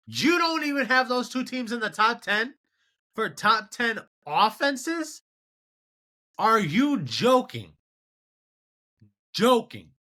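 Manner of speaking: high-pitched, indignant disbelief; real incredulity. Real, not acted. Indignant reaction to top ten offenses ranking.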